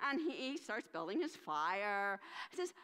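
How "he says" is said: ordinary delivery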